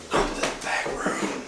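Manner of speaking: slow breathy ghost whisper, barely audible; ghostly whisper. Retold or imitated. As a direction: whisper it like a voice caught on tape. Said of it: "hear this voice on the camcorder go".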